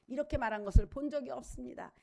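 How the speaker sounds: ordinary talk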